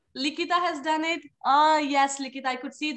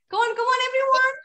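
higher, louder coaxing; urging; 7 semitones higher, 4 dB louder than usual. Real, not acted. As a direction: hurry the class along brightly. Teacher urging class to answer.

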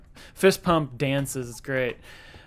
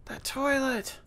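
imitating customer; 10 semitones higher, 3 dB quieter, much slower than usual. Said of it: "She's like".

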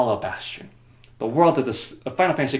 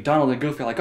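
ordinary delivery

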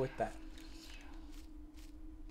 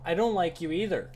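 louder, higher snappy comeback; irritated retort; 4 semitones higher, 6 dB louder than usual. Real, not acted. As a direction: fire it back at her. Snapping back at game voice.

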